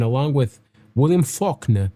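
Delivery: a quick put-on English accent on the name; a hint of an English accent, slipped in. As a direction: say Faulkner like a posh Brit, half-joking. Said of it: "with an English accent".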